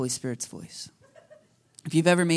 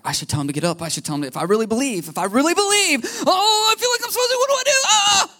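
climbing much higher and louder into a flustered cry; rising panic; 5 dB louder, faster than usual. Retold or imitated. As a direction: spiral into panic as the nerve fails. Panicking about healing someone.

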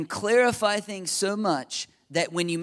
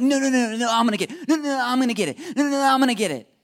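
loud, higher mock squabble over the bill; playful insisting; 7 semitones higher, 5 dB louder, faster than usual. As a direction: cut in eagerly, refusing to back down. Mimed squabble over restaurant bill.

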